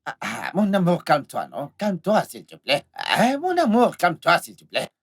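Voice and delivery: low, slow coaxing; placating sweet-talk, dropping low; soothing plea. Acted. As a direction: drag out the endearments to calm a lover. Man soothes his angry lover.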